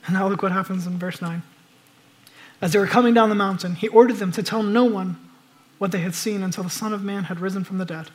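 ordinary talk, nothing marked

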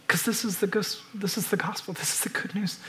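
a reverent whisper. Real, not acted. Hushed reverence in sermon.

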